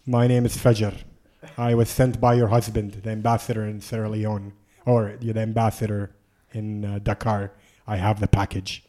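low, soft, measured self-introduction; solemn phone introduction. Retold or imitated. Traveler phoning the ambassador's wife with the package, solemn and low.

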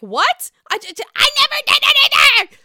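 very high, loud stammered protest; frantic imitation of shrieking innocence; mock shriek. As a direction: screech an outraged denial, hammed up. Imitating Margaret's outraged denial.

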